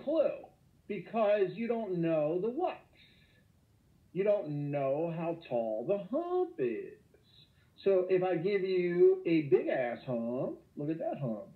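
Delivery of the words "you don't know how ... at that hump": slow emphatic. Real, not acted. Teacher building up the hump.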